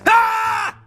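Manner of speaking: a sharp high yelp barked back; mock bark yell. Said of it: "bark back at him".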